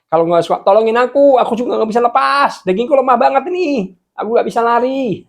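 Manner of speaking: high, loud cry for help; desperate plea. Acted. Weak man answering God back, desperate loud plea.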